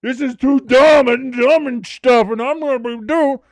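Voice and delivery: loud, slow, hammed-up scoffing; blustering mock disdain; mock miserable grump. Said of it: "be fucking miserable and be like".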